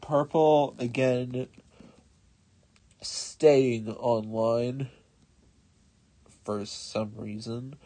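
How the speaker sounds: normal talking voice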